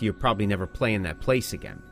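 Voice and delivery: ordinary talk, nothing marked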